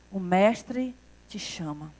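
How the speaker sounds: soft, low and slow, close to the ear; hushed whisper. Retold or imitated. Marta secretly telling her sister Jesus calls, hushed. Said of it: "bem baixinho".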